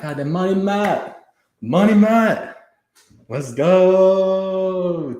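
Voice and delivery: loud, high shout of hype; hyped shout. Real, not acted. Thanking a generous donor in chat, loud excited shout. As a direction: call out the donor's name like a touchdown. Hyping a big donor on stream.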